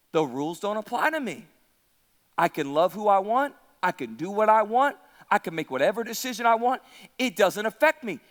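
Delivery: low, quick, offhand brush-off; lightly voiced Samson, low and casual; dismissive Samson. Preacher voices Samson shrugging off the rules, dismissive.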